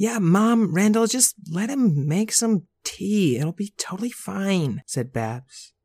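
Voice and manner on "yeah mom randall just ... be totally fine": put-on high, slow, sweet girl voice; grown baby girl. Babs urging Mom and Randall to trust Buster, high and coaxing. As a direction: coax them gently, like a big baby.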